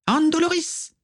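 very high, piercing witch cry; screamed curse. A narrator's reading. Bellatrix casts a torture curse as she nears, high scream. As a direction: shriek the spell at full pitch. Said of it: "hurla Bellatrix".

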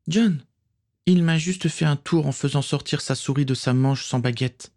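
petite fille qui s'explique, voix haut perchée; explique-toi d'une voix aiguë de fillette; petite sœur. A narrator's reading. (petite sœur rassurant son frère, ton aigu)

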